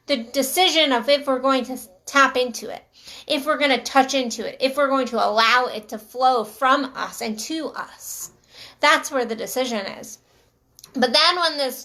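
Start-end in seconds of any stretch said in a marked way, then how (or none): none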